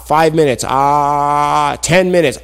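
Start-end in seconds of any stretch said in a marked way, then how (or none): 0.7-1.8 long, steady held "ah"; open-mouthed waiting. Acted. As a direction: hold an "ah" with mouth wide open. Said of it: "he was waiting like this".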